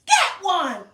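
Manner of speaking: loud, high shout; demo yell. Shown as a demonstration. Teacher showing kids how to yell, loud high shout. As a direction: shout it out for the class to copy. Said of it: "we're gonna yell out".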